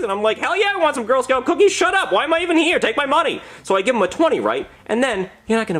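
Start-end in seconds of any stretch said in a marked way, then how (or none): 0.4-3.4 high-pitched comic eagerness; over-eager buyer. Retold or imitated. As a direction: jump at the offer, voice way up. Acted eager buyer at door.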